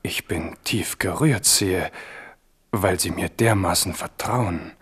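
slow, hushed mock gratitude; whisper. Navarra whispering his false thanks to the king. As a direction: breathe it out, all false humility.

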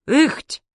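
short high grunt, rising; strained little heave noise; imitated grunt. A narrator's reading. Grandma struggling behind the door, imitated grunt of effort. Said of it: "раздалось за дверью".

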